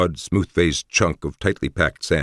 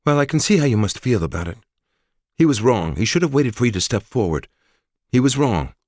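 higher, louder woman warrior conceding; earnest woman's voice; 9 semitones higher, 5 dB louder than usual. Acted. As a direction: admit the wrong firmly, higher. Narrator voices Forrest.

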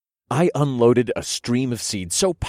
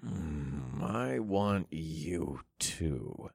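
very quiet, drawn-out growl; low growl; 3 semitones lower, 11 dB quieter, much slower than usual. A narrator's reading. Narrator answering his lover, a soft growl. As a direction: growl it slowly under your breath. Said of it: "I growled".

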